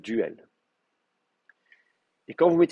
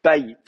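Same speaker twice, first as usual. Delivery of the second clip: Bait as loud BA, then light yit; accent on first syllable; 3 semitones higher, 7 dB louder than usual. Shown as a demonstration. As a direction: hit the start of Bait, drop the ending. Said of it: "Et l'accent reste là".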